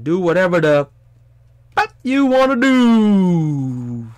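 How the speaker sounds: high, slow, drawn-out imitation; Hodgepodge Twins quote. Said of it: "that's a Hodgepodge Twins reference".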